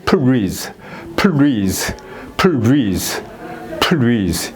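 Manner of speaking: "please" as peu-reez, extra vowel after P; mislearned R-like pronunciation. Shown as a demonstration. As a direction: say "please" in two beats with an R-like second syllable. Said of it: "L 발음이 두 개 들어가 있으면 이거는 R 발음이라는".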